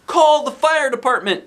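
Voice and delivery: high, loud urgent yell. Acted. Nurse yells for the fire department in alarm. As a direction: shout it across the yard. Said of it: "yelled Missus Cooney".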